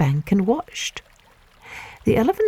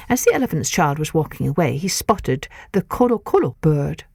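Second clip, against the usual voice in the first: plain reading voice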